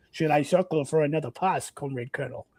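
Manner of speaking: rereads the line in a Russian accent. Shown as a demonstration. Soviet pilot asks his colonel, in Russian accent. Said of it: "I should read that in a, in a Russian accent".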